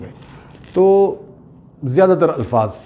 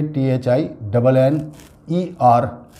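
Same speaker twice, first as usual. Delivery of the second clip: spelling out letters; much slower than usual. Shown as a demonstration. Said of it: "spelling out letters".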